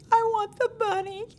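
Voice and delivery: put-on high, tiny child's voice wanting the bunny; little child. Teacher retelling a four-year-old asking for the bunny, high child voice. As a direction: whine it like a small child. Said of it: "the little K four child says".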